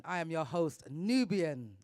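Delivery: ordinary delivery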